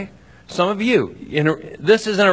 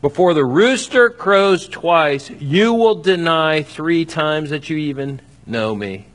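recalled prophecy; slower than usual. Acted. Jesus' words returning to Peter's mind, slow and weighty. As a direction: let each word land like an echo. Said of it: "Jesus' words flashed through Peter's mind".

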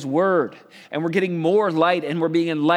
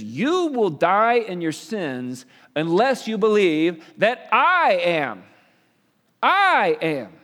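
slow, high and loud, leaning on I am; emphatic slow quote; 4 semitones higher, 4 dB louder, slower than usual. Acted. Preacher voicing Jesus warning the crowd, slow and emphatic. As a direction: deliver it like a solemn claim.

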